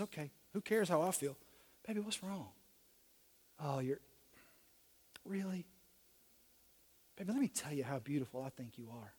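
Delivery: low, hushed husband's sweet talk; tender reassurance. Acted. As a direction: soothe her very softly and low. Husband soothing his hurting wife.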